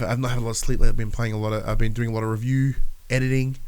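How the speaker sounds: normal talking voice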